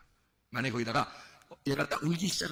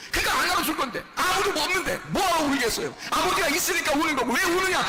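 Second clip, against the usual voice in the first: raised, insistent preacher's voice; fervent preaching; 11 semitones higher, 4 dB louder than usual. Acted. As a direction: press it home, phrase after phrase, loud and high. Preaching builds towards prayer. (열정적으로 외치는 설교)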